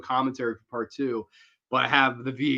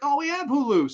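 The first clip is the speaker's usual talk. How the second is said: high mock coworker; 13 semitones higher than usual. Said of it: "they were all like".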